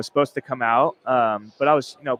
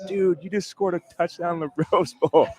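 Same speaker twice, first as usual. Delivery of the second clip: excited teammates; 6 semitones higher than usual. Retold or imitated. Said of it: "guys, you know, just going nuts, like".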